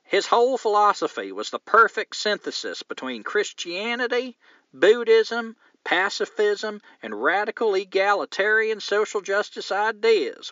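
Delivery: half-lost mock Southern reading; failed Southern accent. Host reading the letter, accent fading off. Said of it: "that didn't sound very Southern".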